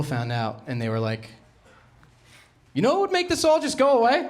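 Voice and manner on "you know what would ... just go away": high, loud mock-official pitch; mock school official. School offering him the crown, in a high mock official voice. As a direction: sell the cover-up like a cheery administrator.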